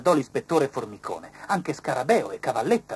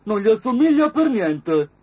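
high, loud beetle voice; beetle's voice; 11 semitones higher, 11 dB louder than usual. A narrator's reading. Beetle doubting the butterfly, high and loud. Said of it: "disse Scarabeo".

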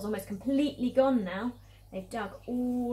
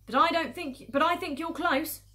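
loud call, pitched up; shouted reply; 7 semitones higher, 7 dB louder than usual. Acted. Farmer Boggis answering Bean, shouting. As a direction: shout the news across to them. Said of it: "shouted Boggis".

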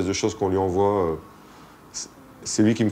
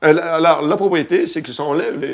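infomercial; 6 semitones higher, 4 dB louder than usual. Pretend. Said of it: "présentateur de téléachat".

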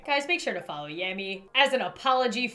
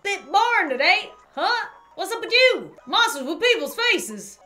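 higher-pitched, lilting character voice; chatty game townsperson; 8 semitones higher, 5 dB louder than usual. Acted. Townsperson greeting a newcomer, chatty character voice. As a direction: voice a townsperson in a high register.

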